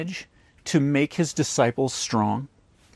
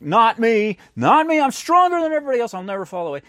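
boastful Peter; 9 semitones higher, 4 dB louder, faster than usual. Retold or imitated. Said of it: "Peter's like".

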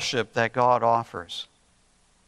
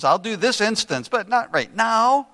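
imitated child's higher-pitched bargaining; negotiating; 7 semitones higher than usual. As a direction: bargain your way out, pitch climbing at the end. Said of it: "still in negotiating mode".

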